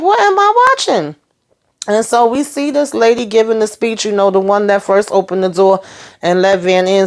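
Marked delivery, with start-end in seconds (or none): 0.0-1.2 very high, incredulous question; baffled disbelief. Retold or imitated. Viewer asking about the episode they saw, baffled disbelief. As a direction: pitch way up in astonishment. Her baffled reaction to the show.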